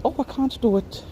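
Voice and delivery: high put-on Scottish brush-off; a quick Scottish no, pitched well up; mock Scottish accent. Man turning down the interviewer, in a mock Scottish accent. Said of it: "his nice little Scottish accent".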